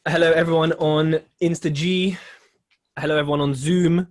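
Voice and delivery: big booming hello to the audience; loud greeting. Real, not acted. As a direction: call out the welcome loudly. Host greeting live audience.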